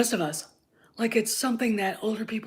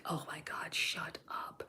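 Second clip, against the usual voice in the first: breathy whisper, far softer; whispered mock reaction; 5 semitones lower, 15 dB quieter than usual. Reacting to the fake show, muttered whisper. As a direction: hiss it under your breath in disbelief.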